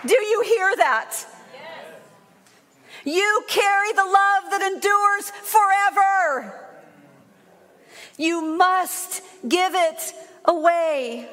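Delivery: high, fervent charge to the congregation; full-force preaching. Real, not acted. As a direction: preach it out high, driving it home.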